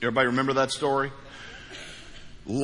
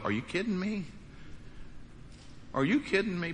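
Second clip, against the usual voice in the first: soft, stunned are you kidding me; a pastor marveling at God's trust, softly stunned; disbelief; 3 semitones higher, 9 dB quieter, faster than usual. Retold or imitated. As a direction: marvel under your breath in disbelief. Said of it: "sit down occasionally and go".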